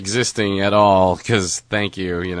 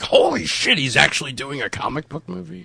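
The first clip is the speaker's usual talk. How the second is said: surprised; 6 semitones higher, 3 dB quieter than usual. Retold or imitated. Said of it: "everybody would be like".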